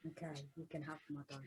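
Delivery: very soft, low, quick mumble; reluctant concession. Acted. Player gives Charlie a dagger, reluctant and very quiet. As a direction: give in under your breath.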